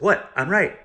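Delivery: mocking blunt cousin. Cousin interrupting him to lecture on bluntness, loud mock. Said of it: "she's like".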